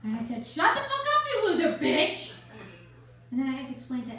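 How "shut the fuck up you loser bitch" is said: high, loud acted outburst; acting out a screaming parent losing it; retold yelling. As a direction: yell it slowly, drawing out the insult. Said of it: "I started yelling".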